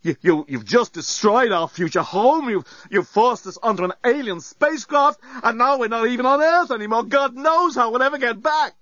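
high stammering hysterics; rising panic, near tears; histrionic. Acted. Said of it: "histrionic mode".

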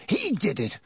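high, rising accusation; teacher shrieking his accusation, mild. A narrator's reading. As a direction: insist on it wildly. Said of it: "shrieked Snape".

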